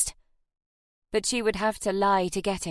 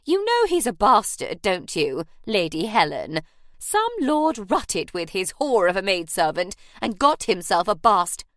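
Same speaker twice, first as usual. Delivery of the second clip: loud, venomous jab; angry; 5 dB louder than usual. Acted. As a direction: sneer the slur, rising in spite. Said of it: "She flushed angrily and said".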